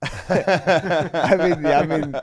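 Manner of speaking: talking through real laughter; laughing. Real, not acted. Friend teases him about family resemblance, laughing reply.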